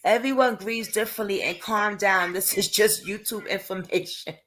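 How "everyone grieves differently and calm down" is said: ordinary delivery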